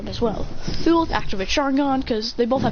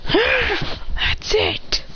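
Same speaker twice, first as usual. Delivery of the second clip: furious outburst; 11 semitones higher, much slower than usual. Acted. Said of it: "Then he goes".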